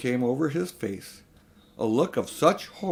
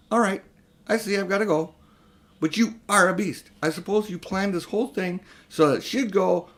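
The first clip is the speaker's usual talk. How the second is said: raised, higher indignant voice; angry boy; 7 semitones higher, 4 dB louder than usual. Acted. Diggory accusing his uncle of a trap, angry. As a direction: throw the accusation at him.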